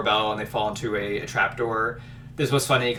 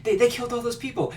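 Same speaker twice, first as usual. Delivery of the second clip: alarmed whisper-shout; 12 semitones higher, faster than usual. Retold or imitated. Companion alarmed, voiced.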